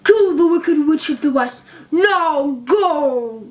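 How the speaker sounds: loud, falling order from a giant; commanding giant head. A narrator's reading. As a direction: dismiss her with a thundering command. Giant head orders Dorothy away.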